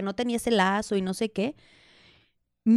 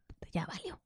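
unvoiced, hushed whisper of dismay; 4 semitones lower, 17 dB quieter than usual. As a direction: whisper it like a secret curse. Panicked inner thought, retold. (susurro asustado)

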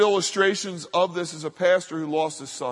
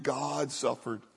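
"God suffered" dropped much softer, slow and hushed; quiet slow echo of "God suffered"; soft, slow repeat; 4 semitones lower, 6 dB quieter, slower than usual. Acted. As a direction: let "God suffered" sink to a near-murmur. Repeated line, softer take.

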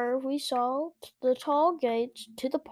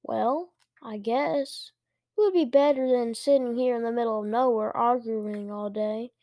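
usual speaking voice

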